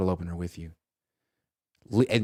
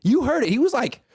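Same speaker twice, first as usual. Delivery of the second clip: usual speaking voice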